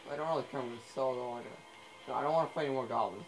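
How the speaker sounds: ordinary delivery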